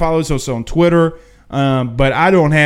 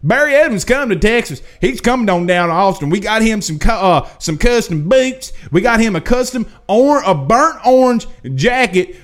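excited higher-pitched insider passing on news; Texas insider; 6 semitones higher than usual. Retold or imitated. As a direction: hype it up like a boasting source. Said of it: "Texas source told me today".

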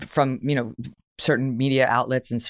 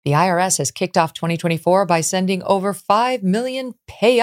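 upbeat, punchy sponsor read; 3 semitones higher, 4 dB louder than usual. Acted. Host reading a tax relief ad to the audience, upbeat ad voice. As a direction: sell it straight to the listeners.

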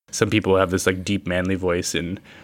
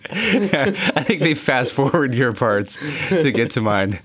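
laughing; 4 semitones higher, slower than usual. Real, not acted. Teasing co-host, slow laugh.

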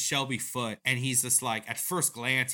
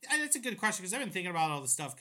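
normal talking voice

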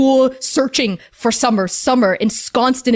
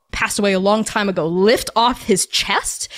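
usual speaking voice

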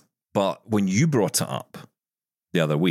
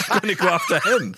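high, jokey tiger with a laugh; imagined tiger; 10 semitones higher than usual. Acted. Tiger deciding to go after the man, playful tiger voice. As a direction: gleefully pick your prey in a put-on higher voice. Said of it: "gigantic tiger saying".

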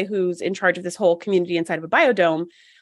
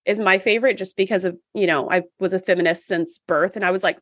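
normal talking voice

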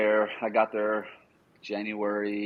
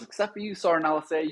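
high-pitched retold sergeant's announcement; mock first-sergeant address; imitating first sergeant; 8 semitones higher, 3 dB quieter, faster than usual. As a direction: raise the pitch to single someone out. First sergeant singling him out, acted.

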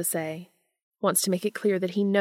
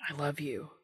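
soft confession; 3 semitones lower, 9 dB quieter than usual. A narrator's reading. Dean confessing love to Castiel.

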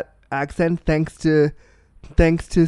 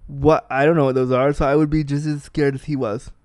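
ordinary talk

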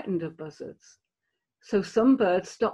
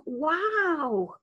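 lapwing wow; 6 semitones higher than usual. Acted. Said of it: "their way of saying".

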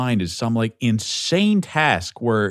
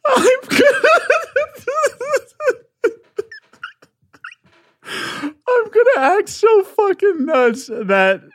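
high, loud giggling through the words; cracking up while pitching the crazy plan; squealing with laughter at his own idea; manic laughing; 4 dB louder than usual. Real, not acted.

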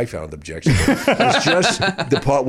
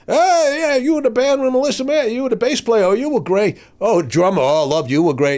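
much higher, loud and gushing; gushing big shot; 11 semitones higher, 3 dB louder than usual. Retold or imitated. Cigar-smoking big shot praising the band at the bar, high and gushing. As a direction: fawn over the band. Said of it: "and he's".

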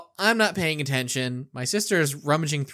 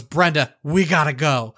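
louder, urgent call to her daughter; 7 dB louder than usual. Retold or imitated. Grandmother calls to her daughter to leave now, loud and urgent. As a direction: hurry them out the door. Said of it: "she goes".

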